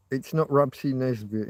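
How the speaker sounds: usual speaking voice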